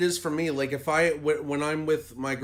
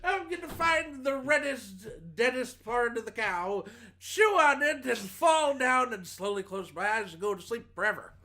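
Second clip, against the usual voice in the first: higher, deliberate comedian impression; raised pitch, mock storytelling; Bill Cosby; 5 semitones higher than usual. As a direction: keep the put-on celebrity voice going. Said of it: "I'm still Cosbying".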